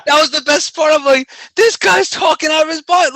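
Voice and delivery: loud, high-pitched, laughing incredulity; hammed-up exasperated squawk; comic indignation. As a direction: rant in mock outrage, voice way up. Retells indignation at announcer, laughing.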